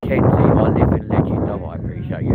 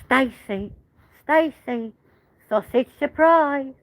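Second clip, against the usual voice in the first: very high, drawn-out soap character impression; Gene Slater; 12 semitones higher, slower than usual. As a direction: call out in a high sing-out voice, stretching the last word. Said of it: "Gene Slater".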